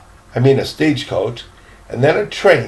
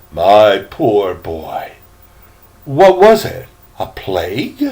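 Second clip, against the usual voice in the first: shocked pity; 7 semitones lower than usual. Acted. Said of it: "in horror".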